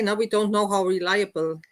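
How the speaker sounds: ordinary talk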